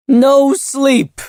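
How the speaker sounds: very high, loud desperate scream. A narrator's reading. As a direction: scream it in panic. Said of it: "screamed Sail".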